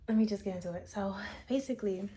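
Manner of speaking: ordinary talk, nothing marked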